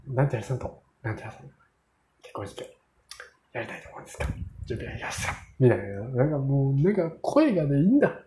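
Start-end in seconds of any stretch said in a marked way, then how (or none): 0.1-5.3 a lightly voiced butler, much softer, fading into a whisper; a hint of the suave wedding butler, breathed out softly; butler's fine voice. The butler asks the couple whether they are ready, in a much softer, whispering voice. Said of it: "声がめちゃめちゃいいんだ".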